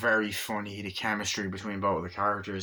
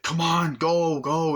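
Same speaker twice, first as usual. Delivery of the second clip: loud, raised-pitch urging; retold urgent shout; 7 semitones higher, 5 dB louder, slower than usual. Arnie urging her to run away, mock urgent shout. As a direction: hurry someone off, as acted from a film. Acting out urging woman to flee.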